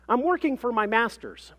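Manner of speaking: high, loud, self-important claim; pompous professional. A narrator's reading. Mother describes her research to the clerk, pompous and assured. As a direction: proclaim it like an impressive credential.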